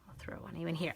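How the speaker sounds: soft hushed whisper. Real, not acted. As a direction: whisper it to yourself. Whispered aside.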